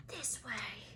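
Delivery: barely voiced hush; mouthed. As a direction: breathe it soundlessly. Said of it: "Harry mouthed to the others".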